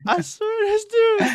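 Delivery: high-pitched laughter through words; can barely speak for laughing; laughing hysterically. Real, not acted. Laughing fit at friend's joke.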